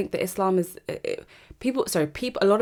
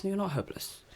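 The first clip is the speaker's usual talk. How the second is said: ordinary talk, nothing marked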